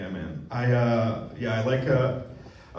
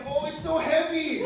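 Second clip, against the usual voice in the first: mock struggling; 11 semitones higher, 4 dB quieter than usual. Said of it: "I see her like".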